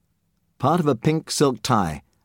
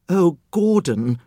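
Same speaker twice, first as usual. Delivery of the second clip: quivering fear; 6 semitones higher, slower than usual. Acted. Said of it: "the woman's lips quivered".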